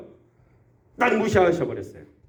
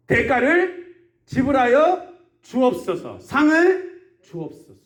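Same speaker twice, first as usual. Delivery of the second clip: slow, raised-pitch acted prayer; solemn sermon-style prayer, rising in pitch; prayerful petition; 4 semitones higher, slower than usual. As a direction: petition God in drawn-out phrases. Acting out a believer's prayer.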